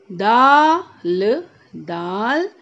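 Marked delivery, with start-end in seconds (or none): 0.1-1.4 दाल with the आ pulled very long, loud; slow loud दाल; stretched aa. Shown as a demonstration. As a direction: hold the आ in दाल. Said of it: "खींच के बोलना है".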